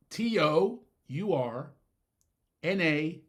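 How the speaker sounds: spelled out slowly. Shown as a demonstration. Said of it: "Slow it down".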